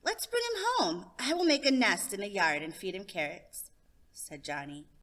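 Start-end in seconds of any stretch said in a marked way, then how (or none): 0.0-3.7 put-on a kid planning a nest; excited child.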